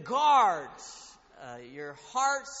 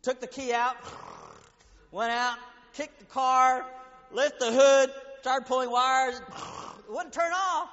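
loud, higher exasperated telling; worked-up storytelling; 7 semitones higher, 12 dB louder, slower than usual. Acted. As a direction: relive the fight with the car. Frustrated car story told loudly.

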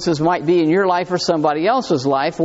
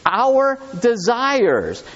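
"Our desires." said very slowly, "our" stressed, falling; slow emphatic repeat; 4 semitones higher, much slower than usual. Shown as a demonstration. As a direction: draw out "Our desires." word by word to drive it home. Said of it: "underline that word".

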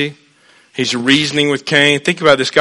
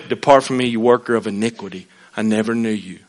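solemn judgment; 7 semitones lower, 9 dB quieter than usual. Acted. God's verdict quoted.

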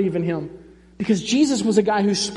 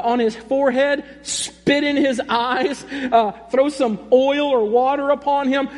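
higher, urgent, pleading; frantic father begging, slowing on the last plea; desperate father; 4 semitones higher than usual. Retold or imitated. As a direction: beg Jesus to hurry, frantic. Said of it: "after this guy was pleading".

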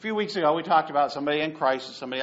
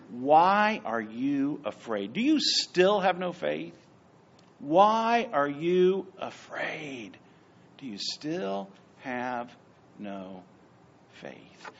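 slow rebuke; 3 dB louder, slower than usual. Acted. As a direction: drag out each question of Jesus. Jesus rebuking disciples, slowed.

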